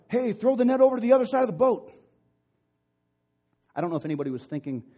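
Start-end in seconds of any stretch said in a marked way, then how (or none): 0.1-1.9 high, loud yell across the water. Acted. Jesus to fishermen from the shore, yelling out. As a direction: holler an instruction to a distant boat. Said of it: "Jesus yells out".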